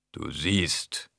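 lightly voiced rumbling bass figure. A narrator's reading. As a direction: rumble it slowly, a little low. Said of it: "brummte die Stimme". (leicht brummende Stimme)

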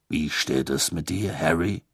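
a hint of a hearty older man; Bagman voice. A narrator's reading. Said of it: "fragte Bagman".

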